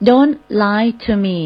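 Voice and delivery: very slow, firm English; slow model phrase. Shown as a demonstration. Teacher showing a blunt reply to scammers, very slow. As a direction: spell the words out slowly. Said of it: "ตรงๆไปเลยก็ได้ค่ะ".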